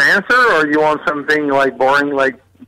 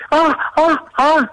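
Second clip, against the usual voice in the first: high, short mock moans; mock movie moaning; 11 semitones higher than usual. Imitating what is heard in wacko movies, high moaning ohs. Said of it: "in the kind of wacko movie".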